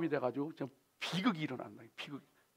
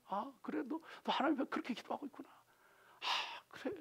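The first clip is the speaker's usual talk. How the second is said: gentle listening; 9 semitones higher, 8 dB quieter than usual. Retold or imitated. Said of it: "그냥 들어주는 거예요".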